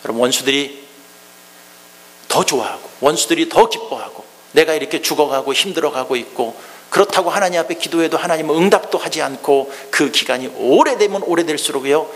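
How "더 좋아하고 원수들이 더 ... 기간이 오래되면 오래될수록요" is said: usual speaking voice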